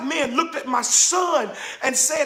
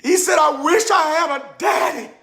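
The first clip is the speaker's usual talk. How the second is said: plaintive cry, pausing before "daddy"; overcome with emotion; 3 semitones higher than usual. Retold or imitated. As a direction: let the longing swell and catch on "daddy". Retelling boy's words that broke him.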